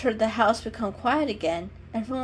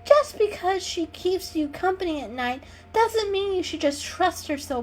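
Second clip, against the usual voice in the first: snooty cat voice; 9 semitones higher than usual. Acted.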